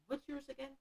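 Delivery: ordinary talk, nothing marked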